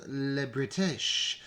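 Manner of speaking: mock French accent. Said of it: "I did a French accent for the British".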